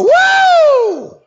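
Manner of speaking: very high, loud woo; mock church woo. Preacher mimicking churchgoers at resurrection talk. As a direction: let out a joyful whoop. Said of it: "we're supposed to go".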